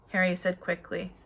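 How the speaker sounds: usual speaking voice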